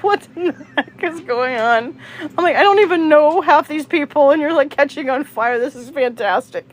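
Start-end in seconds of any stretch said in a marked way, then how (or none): 0.0-2.0 high, giggly mock bewilderment; laughing disbelief. Storyteller reacting to pants catching fire, laughing disbelief. 2.6-6.7 high, amused retold rant easing down; mock exasperation. Storyteller reacting to a man catching fire, mock exasperation.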